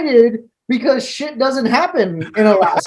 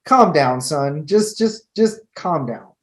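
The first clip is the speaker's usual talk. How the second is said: low, soothing voice; calming a rookie; 6 semitones lower than usual. Retold or imitated. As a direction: settle him down, lower and slower. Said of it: "I'm like".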